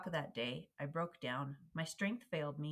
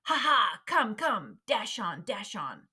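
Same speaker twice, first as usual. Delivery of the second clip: loud, high-pitched taunting yell, falling on each phrase; screamed out; 5 semitones higher, 12 dB louder, slower than usual. A narrator's reading. Slave breaker surprising the field hands, screamed out. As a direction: scream it out in sudden bursts. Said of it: "scream out".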